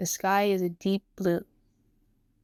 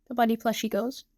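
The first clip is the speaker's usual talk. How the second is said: ordinary delivery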